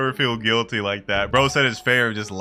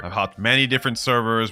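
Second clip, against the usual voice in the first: usual speaking voice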